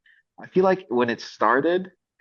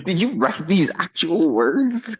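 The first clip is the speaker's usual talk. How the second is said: slow, higher-pitched disbelief, each word weighed; drawn-out, raised incredulous question; 7 semitones higher, slower than usual. Retold or imitated. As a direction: question the writer as if it can't be true. Repeats disbelieving challenge to writer.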